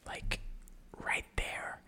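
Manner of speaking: hushed whisper so neighbors sleep; breathy late-night whisper. Shown as a demonstration. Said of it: "this episode to be fully whispering".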